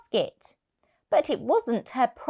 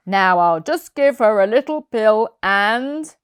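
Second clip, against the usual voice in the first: louder, cheerful vet at work; a brisk, pleased vet; vet's voice; 7 dB louder than usual. Acted.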